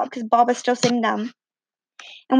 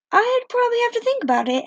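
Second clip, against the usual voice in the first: flustered reply; 10 semitones higher than usual. Retold or imitated. Flustered answer to being asked out.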